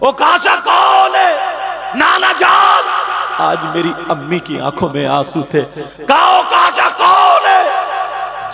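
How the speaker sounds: high, loud, slow demand; child's fierce shout. Acted. Little Husain demanding who Okasha is, shouting. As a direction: call out boldly, ready to take the lashes.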